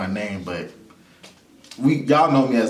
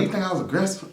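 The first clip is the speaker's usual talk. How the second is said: high, indignant questioning; a lightly voiced friend taken aback; high incredulous echo; offended surprise; 9 semitones higher, 3 dB quieter, faster than usual. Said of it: "And she's like".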